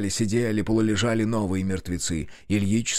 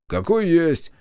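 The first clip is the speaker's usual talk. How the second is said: gruff grumble; 6 semitones higher, 4 dB louder, slower than usual. Acted. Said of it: "буркнул Тошка в ответ".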